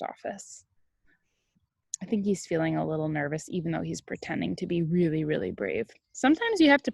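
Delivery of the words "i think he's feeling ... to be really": plain reading voice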